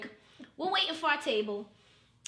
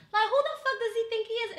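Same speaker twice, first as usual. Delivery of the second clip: higher-pitched retold outrage; indignant venting; 8 semitones higher, 4 dB louder than usual. Calling her boy about the date, indignant venting. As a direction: vent it in mock disbelief, pitched up.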